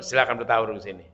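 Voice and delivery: ordinary talk, nothing marked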